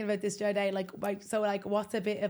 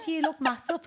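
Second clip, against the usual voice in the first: Irish compliment; 7 semitones higher, 4 dB louder than usual. Retold or imitated. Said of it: "I said".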